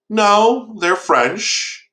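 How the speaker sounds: mock-innocent, high drawn-out dodge; evasive retelling. Monster dodging the old man's question, evasive. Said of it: "kinda skirting around the issue here".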